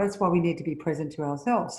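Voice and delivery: normal talking voice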